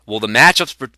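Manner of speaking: ordinary talk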